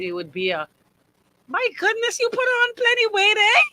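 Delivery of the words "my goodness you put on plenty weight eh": high, loud mock exclaim over someone's weight; exaggerated Bahamian busybody exclaiming; nosy gossip voice. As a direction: play the tactless auntie, pitched way up. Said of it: "In that same voice intonation and everything".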